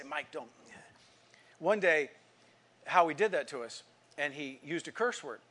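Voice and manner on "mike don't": soft, low warning; hushed caution. Retold or imitated. Boy restraining his agitated friend, hushed. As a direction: quietly hold a friend back.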